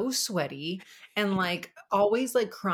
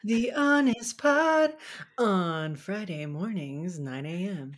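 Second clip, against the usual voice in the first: slow, drawn-out radio announcer; much slower than usual. Acted. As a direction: announce the show name grandly. Announcer tagline.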